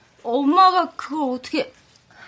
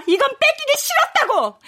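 higher, hurt cry; tearful outburst; 9 semitones higher than usual. Acted. Daughter tells her mother she wanted this, hurt outburst. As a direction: burst out with resentment.

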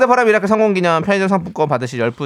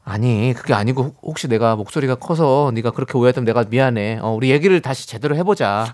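lower-pitched, even reassurance; calm apology; 5 semitones lower than usual. Shown as a demonstration. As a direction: explain and apologise without heat. Counselor models a calm apology. (낮고 차분하게 사과하듯)

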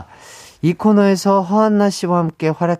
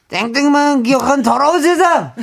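catchphrase shout; 10 semitones higher than usual. Retold or imitated. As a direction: belt the catchphrase. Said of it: "박성광 씨의 대사가 유행어".

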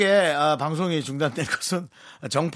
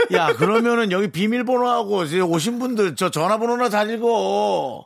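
laughing; 6 semitones higher, 4 dB louder than usual. Real, not acted. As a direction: tease him through laughter. Teasing co-host, laughing. (웃으면서 놀리듯)

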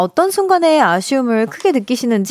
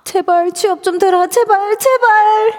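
high-pitched acted begging, building louder; desperate pleading; 10 semitones higher, 3 dB louder than usual. Job seeker praying to get hired, desperate pleading. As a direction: plead ever harder, hammed-up.

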